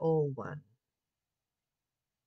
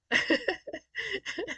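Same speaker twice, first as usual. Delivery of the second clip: high laugh; 4 dB louder than usual. Real, not acted. Amused at own struggle.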